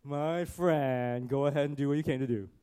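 loud, sneering mock friendliness; put-on sarcastic taunt. Shown as a demonstration. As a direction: say it with biting sarcasm, quick at the end. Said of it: "did not say this in a sarcastic way".

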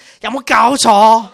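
high, very loud Cantonese exclamation; mock Chinese outburst. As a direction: shout it in comic outrage in Cantonese. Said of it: "They're all talking in Chinese".

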